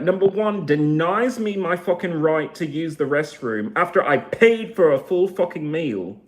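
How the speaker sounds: heated and loud, pitch riding high; angry rant. Real, not acted. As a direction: confront them, punching the swear words.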